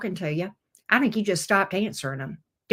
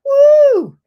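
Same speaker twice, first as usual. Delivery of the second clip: loud woo; 12 dB louder than usual. Real, not acted. Exclaims at the card.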